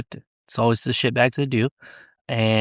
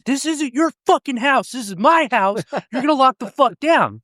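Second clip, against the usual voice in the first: high, forceful yell at an inmate; big boy voice; 14 semitones higher than usual. Retold or imitated. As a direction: bark the order to take control. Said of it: "I'd use my big boy voice".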